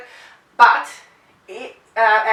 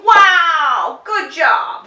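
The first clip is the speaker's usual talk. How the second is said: high, loud "wow, good job"; big excited praise for the dog; 6 semitones higher, 6 dB louder, much slower than usual. Shown as a demonstration. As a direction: burst out with happy praise. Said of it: "you're gonna go".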